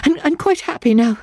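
high, hurried woman's voice, grateful; a frightened woman pressing her thanks; high, eager pleading thanks; breathless eager woman. A narrator's reading. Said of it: "She spoke with breathless eagerness".

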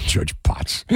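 breathless whisper through laughter; half-whispered giggling name; laughing. Real, not acted. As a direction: say it while cracking up.

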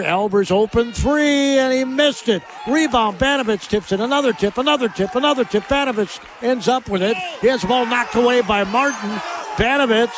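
excited live rebound call; urgent play-by-play. Real, not acted. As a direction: chase the loose ball with your voice. Scramble for rebound called live.

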